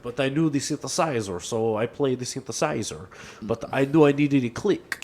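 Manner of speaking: put-on European accent, spoken monologue. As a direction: imitate the producer's accented storytelling. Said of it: "[name] calls it in that really good Daft Punk song".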